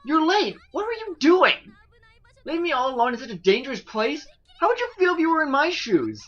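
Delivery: high, loud indignant scold; scolding girl. Acted. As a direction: tell him off for being late, high pitched.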